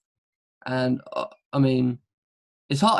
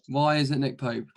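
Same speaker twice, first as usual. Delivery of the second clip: usual speaking voice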